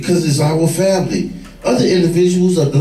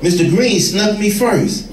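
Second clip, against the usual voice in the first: ordinary talk, nothing marked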